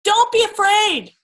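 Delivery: high, loud, stretched-out sideline yell. Retold or imitated. Soccer mom to her frightened son, a loud drawn-out yell. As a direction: call it out to a scared kid on the field. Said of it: "it was so tempting to yell".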